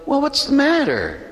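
high-pitched concerned question; acted pastor, voice lifted high. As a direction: ask it high, imitating a concerned greeter. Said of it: "And he goes".

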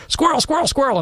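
high, frantic cry of squirrel squirrel; excited squirrel shout. Retold or imitated. French friend spotting a squirrel, shrieking excitedly. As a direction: yelp "squirrel" way up high, beside himself. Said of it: "nearly has apoplexy".

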